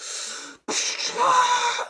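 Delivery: long high exasperated sigh. Real, not acted. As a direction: let out a big fed-up sigh. Exasperated at the casting announcement.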